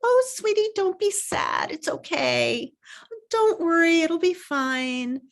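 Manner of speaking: put-on mother reassuring her little kids, soothing; soothing parent. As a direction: comfort a sad child, lightly hammed. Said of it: "I used to say to them".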